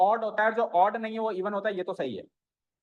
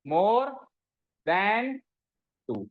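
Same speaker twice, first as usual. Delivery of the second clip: MORE, pause, THAN, pause, TWO; word-by-word dictation; 2 semitones higher, much slower than usual. Shown as a demonstration. Teacher giving students the words to copy, word-by-word dictation. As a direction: hang MORE and THAN on a rising tone, pausing after each.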